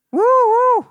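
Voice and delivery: very high, loud mock woo-woo whistle; shrill flirty woo woo call; wolf whistle. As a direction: whistle at him like a catcaller. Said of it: "They were whistling at me".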